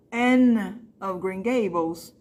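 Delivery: Anne of Green Gables in English, slowly; English title read slowly. Shown as a demonstration. Teacher giving students a memory aid, slowly in English. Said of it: "pra vocês terem certeza".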